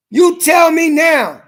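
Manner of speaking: loud, high, drawn-out challenge; forceful demand. Retold or imitated. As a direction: raise your voice and slowly confront the judge. Retelling his demand to the judge.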